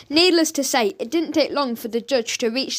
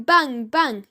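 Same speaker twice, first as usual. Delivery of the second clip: plain reading voice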